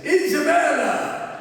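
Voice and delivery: a loud, high, hammed-up Italian call of Isabella; bad Italian accent, calling out slowly; fisherman's cheery Italian hail, in a mock Italian accent; poor Italian accent. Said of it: "that's a pretty poor Italian accent".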